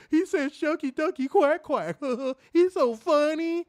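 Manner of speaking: high, loud imitation of tickled onlookers; hammed-up delighted giggling; mocking laughter. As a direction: laugh through the words in a silly high voice. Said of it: "they be laughing".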